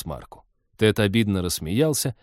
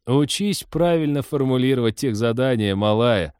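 ordinary delivery

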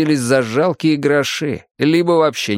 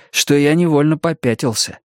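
ordinary delivery, nothing marked

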